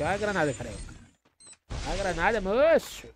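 high, loud warning cry; excited shout. Real, not acted. Grenade thrown at player.

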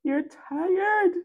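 very high, mock-tearful whine; teasing high wail on "tired"; mocking squeal. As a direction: squeak it out after laughing. Squeaked taunt out of laughter.

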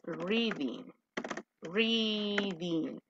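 "reading" stretched slowly; slow teacher's demonstration; drawn-out model word. Shown as a demonstration. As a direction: draw out both syllables of "reading" for the class. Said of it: "Si vas a ocupar el verbo auxiliar".

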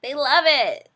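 a loud, high burst of glee; pleased exclamation about fans; delighted. Retold or imitated. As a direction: crow it like a happy surprise. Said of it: "and I'm like".

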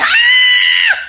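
very high, loud mock scream; piercing imitation of a screaming viewer; imitated scream. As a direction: scream it out as a mock fan shriek. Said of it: "when you're like".

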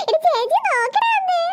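put-on high, drawn-out baby talk; cooing to baby. Sister wants the baby to look, high cooing. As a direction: coax the baby in a slow, high singsong.

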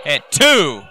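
excited ringside play-by-play; wrestling commentator. Acted. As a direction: call the near fall live. Live play-by-play of the match.

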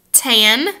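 "Tan." with a crisp front N. Shown as a demonstration. Teacher drilling tan against tang, front N. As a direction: close "Tan." on the tongue tip. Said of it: "Say tan".

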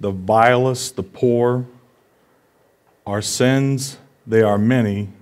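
slow, tender recitation of a verse; poem reading. Acted. Pastor reading a poem to the congregation, slow and tender. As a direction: read the poem gently, letting each line land. Reads a poem quote slowly.

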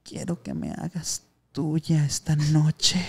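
slow, drawn-out mock seduction; a passenger's slow teasing proposition to the Uber driver; mock seductive. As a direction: stretch it out like a hammed-up come-on.

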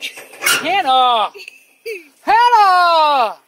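loud calling out; shouting name. Real, not acted. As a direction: yell her name across the park. Dad yelling at kid on ride.

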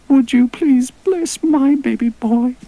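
very high, loud squeaky little creature; tiny coy critter asking a favour; cute piping voice. Acted. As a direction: coo the request sweetly. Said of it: "piped a Dibble, all cute and coy".

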